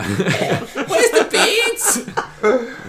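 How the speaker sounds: exaggerated high Liverpool Scouse, laughing through it; Scouse accent. Podcast host doing a laughing Scouse accent for listeners. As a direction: ham up a Scouse lad asking for the birds. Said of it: "that's a Scouse accent from Liverpool".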